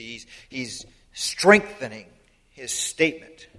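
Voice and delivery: normal talking voice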